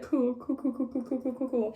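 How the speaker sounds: soft, rattled-off string of words; retold numb, quiet acknowledgement; muted repeated acceptance. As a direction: mumble it on repeat, barely reacting. Retold dazed reaction to nurse.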